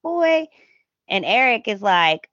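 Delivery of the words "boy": put-on high, disapproving "Boy"; scolding "boy". Viewer scolding the rude son, high reproachful "Boy". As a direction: scold him like a sassy aunt. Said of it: "I'm like".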